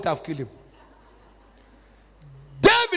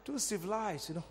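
resigned aside; 3 semitones lower, 18 dB quieter than usual. Acted. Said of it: "God said".

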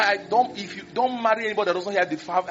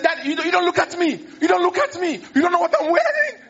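a hammed-up tearful wife, raised and pleading; wife's hurt outburst; 10 semitones higher than usual. Wife's tearful complaint to husband.

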